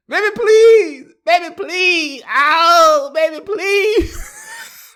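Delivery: put-on high, loud wailing plea for baby; pleading with his ex in the nail salon, high wailing beg; desperate begging. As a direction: beg her back at the top of your voice, hammed-up.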